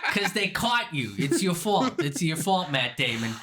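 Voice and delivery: high, insistent Boston scolding; exaggerated Boston accent. Bostonian blaming Matt Damon, exaggerated Boston accent. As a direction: hammer the blame home in broad Boston. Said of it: "really exaggerating the Boston accent".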